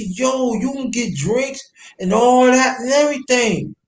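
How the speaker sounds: high-pitched insistent persuasion; retold coaxing. Coaxing a reluctant ex to come along, insistent. Retelling his pleading with ex.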